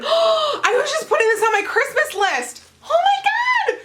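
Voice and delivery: high, laughing squeal of excitement; squealing with delight. Real, not acted. Unboxing a wished-for gift, a delighted squeal. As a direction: gasp and squeal, overjoyed. Thrilled to find the scrub.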